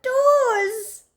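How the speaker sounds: high, very slow delighted exclamation; giraffe exclaiming. A narrator's reading. Giraffe finds the toes, high and amazed. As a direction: gasp of discovery.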